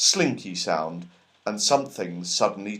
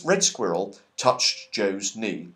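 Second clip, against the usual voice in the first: ordinary delivery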